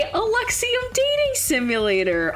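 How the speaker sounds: high, bouncy announcer energy; big high-pitched stream opener; excited intro. Real, not acted.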